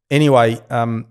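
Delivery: ordinary talk, nothing marked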